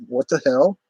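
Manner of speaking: mock outraged disbelief; retold indignation. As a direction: replay an offended double take. His reaction to being accused.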